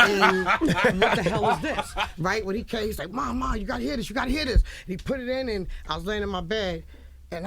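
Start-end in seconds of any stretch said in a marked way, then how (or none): 0.0-1.9 loud, slow disbelief at what you are hearing; incredulous outburst. Retold or imitated. Acted reaction to hearing the record. 3.1-4.6 imitating an excited son, pitched higher; eager son. Voices son rushing in with record.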